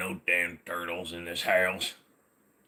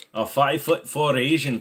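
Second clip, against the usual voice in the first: usual speaking voice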